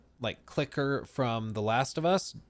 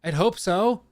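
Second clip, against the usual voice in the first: sarcastic; 7 semitones higher, 9 dB louder, slower than usual. Retold or imitated.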